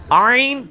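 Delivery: "آئین" loud, from the throat; firm throaty "آئین"; letter ain. Shown as a demonstration. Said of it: "لیکن یہ آئین کے ساتھ،".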